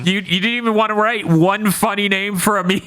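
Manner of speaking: high-pitched mock protest; squeaky put-on outrage at a lazy job; mock incredulous. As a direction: pitch it way up in hammed-up disbelief. Acted protest at lazy episode writers.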